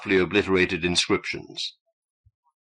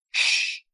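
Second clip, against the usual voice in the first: unvoiced hush. A narrator's reading. Silencing Prentice.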